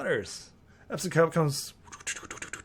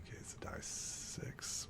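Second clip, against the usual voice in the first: barely voiced whisper; 18 dB quieter than usual. Real, not acted. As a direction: whisper it to yourself. Muttering dice result.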